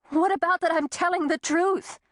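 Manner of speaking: high, pleading and strained; desperate plea. A narrator's reading. Woman pleading with her doctor, high and desperate. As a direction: beg him to believe her.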